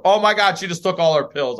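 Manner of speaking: mock-horrified exclamation; shocked nurse. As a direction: blurt it out like an aghast nurse.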